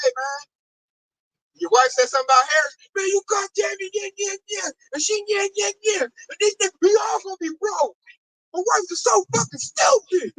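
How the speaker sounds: hammed-up retelling of a coworker going off; imitating a rant. Coworker venting about his wife, imitated as a mock angry rant. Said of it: "he just going off".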